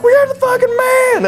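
very high hollered brag; rowdy fan hype. Retold or imitated. Fans hyping him up.

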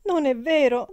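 high, loud denial; crying out. A narrator's reading. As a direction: shout it through tears. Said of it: "gridò la ragazza". (grido disperato)

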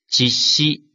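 実施 read as Japanese "jisshi", the 実 clipped to "jis"; 実施 said the Japanese way, "jis-shi". Shown as a demonstration. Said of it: "一般读作基兹".

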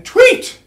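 a very high, loud "Tweet!"; whistle imitation. A narrator's reading. Narrator imitating the traffic cop's whistle, high and loud. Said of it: "sang the cop's loud whistle".

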